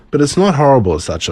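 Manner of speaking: ordinary delivery, nothing marked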